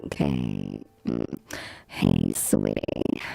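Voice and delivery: very low, creaky croak; slow, deep seductive rasp; sick-voice fry. Shown as a demonstration. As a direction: croak a sultry greeting. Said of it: "make myself sound like that".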